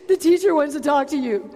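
gasping, sugary fake excitement; mock the disciples' sudden sweetness; hammed-up phony delight; mock fake enthusiasm. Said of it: "they're like".

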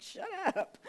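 high-pitched playful shush; mock indignant. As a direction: cut him off in squeaky pretend outrage, laughing around it. Mock scolding her husband's aside.

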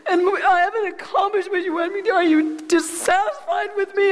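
high, tearful ranting at God, half laughing. Retold or imitated. Woman in hospital rants at God, high and tearful. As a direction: pour out a teary rant of hurt and blame. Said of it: "And this is how I was talking".

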